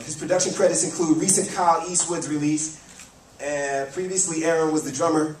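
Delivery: ordinary talk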